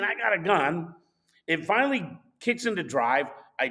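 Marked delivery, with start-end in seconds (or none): none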